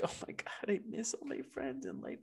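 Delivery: breathy whispered whine, high; mock whiny celebrity. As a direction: imitate a whiny celebrity in a hushed wail. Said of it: "And she's like".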